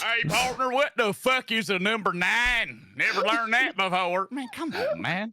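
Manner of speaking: high, slow hammed-up cowboy drawl; mock cowboy. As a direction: call out like a clueless cowboy. Cowboy parody, louder call.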